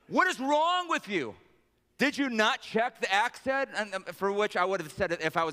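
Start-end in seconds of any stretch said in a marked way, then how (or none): 0.1-3.7 high, exasperated telling-off, opening drawn out; mock scolding. Prophet berates the clumsy servant, mock-exasperated. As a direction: berate him in hammed-up outrage, pitched up.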